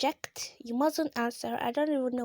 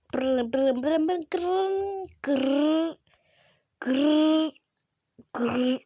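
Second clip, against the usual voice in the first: rolled brr then louder grrr growls; effort growls; 5 dB louder, much slower than usual. Real, not acted. Player growling while struggling with the controls. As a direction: growl through the hard part.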